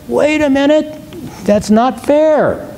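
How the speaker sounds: high-pitched mock protest, "fair" drawn out; protesting cry. Teacher voicing our great cry against God's choice, protesting. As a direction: object in a high, stretched-out complaint. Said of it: "our great cry is that".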